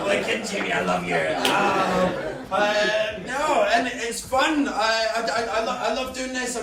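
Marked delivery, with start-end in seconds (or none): 0.1-1.2 much lower, creepy mock whisper of affection; a lightly voiced leering old man.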